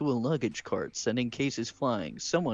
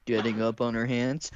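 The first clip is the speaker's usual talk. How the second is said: usual speaking voice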